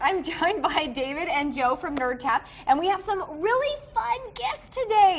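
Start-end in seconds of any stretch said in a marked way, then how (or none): 2.7-5.2 really excited, pitch climbing high and drawn out; high, gushing excitement, savoring the words; excited, voice getting high. Real, not acted. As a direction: let excitement push your voice way up. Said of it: "my voice is getting all high 'cause I'm really excited".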